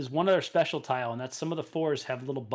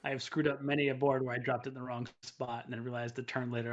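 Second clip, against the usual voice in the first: normal talking voice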